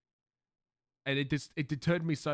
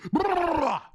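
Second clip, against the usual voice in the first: loud, high lip-trill "brrr"; resetting trill; 13 semitones higher, 8 dB louder than usual. Shown as a demonstration. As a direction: shake the voice loose with a big brrr. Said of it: "I gotta reset back".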